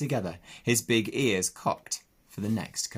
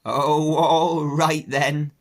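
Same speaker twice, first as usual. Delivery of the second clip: put-on slow, louder, higher croaky old ewe; an old country sheep croaking; Ma the old ewe questioning the pig; 6 semitones higher, 9 dB louder, much slower than usual. Ma answering.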